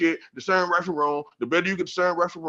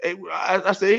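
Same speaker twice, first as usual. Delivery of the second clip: usual speaking voice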